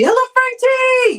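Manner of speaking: high sing-out hello; called-out greeting. Real, not acted. Streamer welcomes a viewer to the chat, calling out high. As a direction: hail them in a high, held voice.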